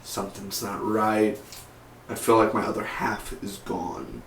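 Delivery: hushed, as if sensing a distant loss; Sarah. Acted. Reviewer playing Sarah sensing Laurel's death, hushed. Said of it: "and Sarah somewhere in twenty-one forty-seven".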